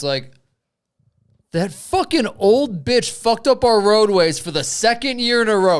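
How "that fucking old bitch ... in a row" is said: rile up the crowd in pretend fury; hammed-up angry townsperson; mock outraged voter.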